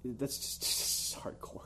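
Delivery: unvoiced, breathy aside; whisper. Real, not acted. Host whispering at cohost's joke, whisper. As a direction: whisper it in mock shock.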